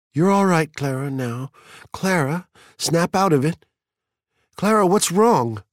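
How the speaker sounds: high, loud, fretful consoling; urgent consoling. A narrator's reading. Mildred urges her sobbing friend, high and anxious. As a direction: try to snap a friend out of it.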